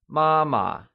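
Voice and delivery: "mama" with a high, level first syllable. Shown as a demonstration. Teacher models the Mandarin word for mother, "mama" in high level tone. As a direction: keep the start of "mama" level. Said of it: "pronounced using the high level tone".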